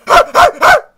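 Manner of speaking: high, very loud burst of laughter; three sharp high laughs. Real, not acted. Testing a straight face, loud high laugh.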